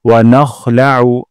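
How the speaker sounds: "নাখলাউ" with a throaty খ, not কাফ; slow, scrape the kh from the throat; guttural kh sound. Shown as a demonstration. Teacher correcting a common কাফ mistake. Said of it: "গলার মাঝখান থেকে".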